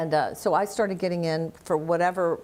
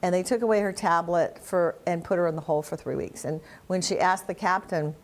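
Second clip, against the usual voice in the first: ordinary talk, nothing marked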